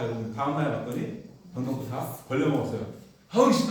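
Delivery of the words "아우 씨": loud, high cry of annoyance; exasperated outburst. Acted. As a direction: curse the heat in acted frustration. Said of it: "더워 죽겠네". (더위에 짜증이 폭발하듯)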